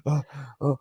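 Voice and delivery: imitating someone gasping from laughter; mock breathless, barely getting it out; out of breath. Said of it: "he can't even catch his breath".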